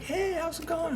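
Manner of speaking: high-pitched, soft hello to a friend; lightly acted cheery greeting; retold friendly greeting.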